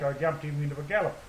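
ordinary delivery

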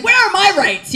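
indignant whine. Retold or imitated. Mock protest as a kid.

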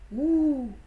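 cri aigu et fort venu de derrière un arbre; ouh mystérieux. Acted. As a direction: lancer un ouh pour intriguer. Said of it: "fit alors une voix". (ouh mystérieux, aigu et fort)

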